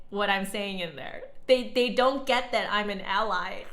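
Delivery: ordinary delivery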